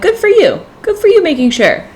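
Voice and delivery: approving cheer. Retold or imitated. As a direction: cheer her on like a proud fan. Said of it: "which I'm like".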